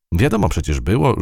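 usual speaking voice

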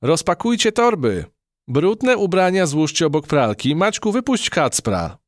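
high, bossy mum giving orders; commanding mother. Acted. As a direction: order the kids about like a busy mother. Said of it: "komenderowała mama Helenki".

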